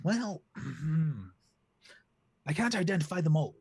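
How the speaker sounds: high, prim duck butler; lightly high duck servant identifying cars. Acted. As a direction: clear the throat and report primly, up high. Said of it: "Sir William looks and goes".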